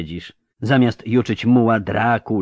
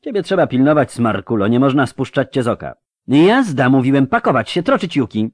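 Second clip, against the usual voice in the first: loud, raised-pitch brisk orders; commanding; 6 semitones higher, 6 dB louder than usual. A narrator's reading. Geralt sending the crew off to pack, firm and urgent. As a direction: take charge and send everyone moving.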